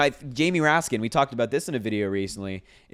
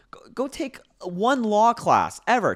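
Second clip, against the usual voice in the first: ordinary talk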